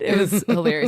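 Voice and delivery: ordinary delivery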